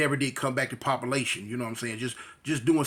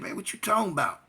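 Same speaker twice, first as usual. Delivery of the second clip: dismissive repeat; 4 semitones higher than usual. Retold or imitated. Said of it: "That's how he said it".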